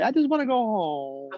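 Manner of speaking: light sing-song child imitation; sing-songy kid voice. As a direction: echo the preschooler's whine. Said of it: "a sing-songy voice".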